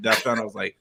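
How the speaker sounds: ordinary delivery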